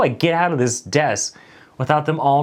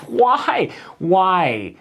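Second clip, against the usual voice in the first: baffled why; 5 semitones higher than usual. Retold or imitated. Baffled reply to coworkers.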